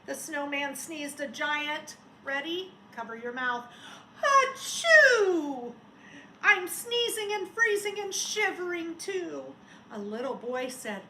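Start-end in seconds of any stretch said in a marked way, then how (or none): 4.2-5.7 loud high acted sneeze; teacher acting a snowman's giant sneeze; big sneeze. Snowman sneezes, told to cover. 6.4-9.6 higher, louder cold snowman voice; shivering snowman. Acted.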